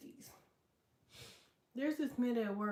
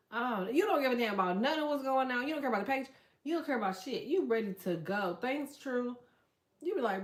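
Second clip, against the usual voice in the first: ordinary talk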